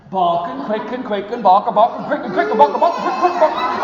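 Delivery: rapid, loud, laughing chant; fast duck chatter. Acted. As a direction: rattle it off giggling. Duck rattles off words.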